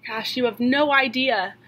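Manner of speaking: ordinary talk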